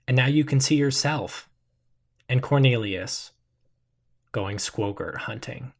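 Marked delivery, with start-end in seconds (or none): none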